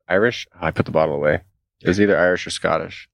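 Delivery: ordinary talk, nothing marked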